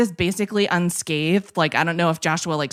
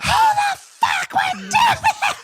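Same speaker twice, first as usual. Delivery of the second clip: high, slow shriek of outrage; mock shrieking farm wife; retold scream; much slower than usual.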